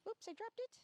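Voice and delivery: high, quick surprise; surprised. Real, not acted. Trainer surprised at dropping the treat, high. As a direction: catch yourself dropping it.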